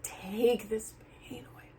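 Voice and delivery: hushed retold plea to God; soft desperate whisper; whispered prayer. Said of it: "like, like".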